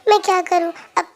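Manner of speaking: ordinary delivery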